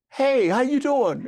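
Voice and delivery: high, loud, overeager hello; nervous guy trying to sound smooth; eager greeting. Retold or imitated. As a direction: play it cool but too excited. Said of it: "so I'm like".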